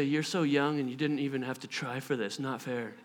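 low, very soft complaint; mock sulk. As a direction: grumble enviously under the breath. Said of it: "she doesn't say".

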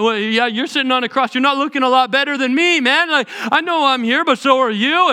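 high, loud scornful jibes; insulting back. Pretend. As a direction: throw the insults back at him. Criminal imagined mocking back.

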